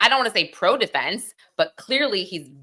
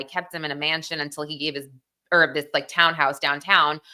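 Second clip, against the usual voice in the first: usual speaking voice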